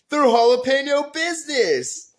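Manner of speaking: loud, high, drawn-out joke delivery; big goofy shouted payoff; hammed-up punchline. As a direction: belt out the corny pun. Hammed-up punchline told to customer.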